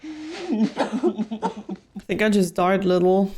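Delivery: laughing through the words. Real, not acted. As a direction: chuckle while saying it. Reacting to a shocking scene.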